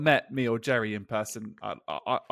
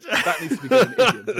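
laughing; 10 semitones higher, 6 dB louder than usual. Real, not acted. Laughing at the odd phrase.

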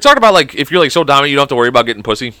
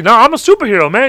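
high protesting character voice; Teeny Weeny Strangler; 10 semitones higher, 4 dB louder than usual. Acted. Superhero defending himself to his victim, high protest. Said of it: "he's like".

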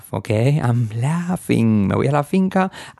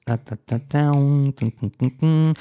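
vocal guitar riff, da dun; hummed guitar riff; 2 semitones higher than usual. Shown as a demonstration. Teacher imitates the riff of a rock song. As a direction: mimic the guitar riff with your voice. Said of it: "their guitar riff, it goes".

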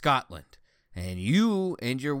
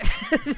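laugh; 8 dB louder than usual. Real, not acted. Laughing at own impression.

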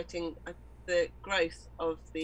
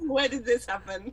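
louder mock-baffled question to a child; mock bafflement; 4 semitones higher, 6 dB louder than usual. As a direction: act out bewilderment at your own kid. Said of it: "I look at her sometimes like".

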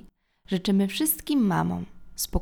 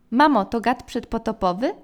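usual speaking voice

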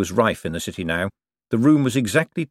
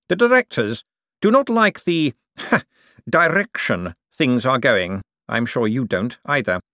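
smooth, pressing Cosmo; 5 semitones higher, 3 dB louder than usual. Acted. Cosmo airs the directors' displeasure to Bent, smooth and pressing.

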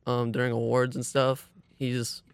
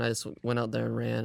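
usual speaking voice

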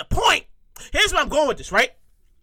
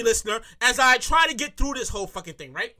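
usual speaking voice

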